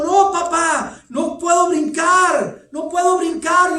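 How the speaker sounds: high, loud, slow pleading cries; frightened son. Retold or imitated. Trapped son calls down to his father, panicked refusal. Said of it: "El hijo continuaba diciendo".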